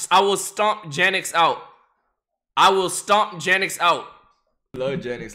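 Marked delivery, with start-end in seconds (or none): 0.1-4.1 loud, pumped-up declaration; hype shout. Pretend. Streamer yelling at chat about beating Janix, pumped shout. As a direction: roar it like a fight promo.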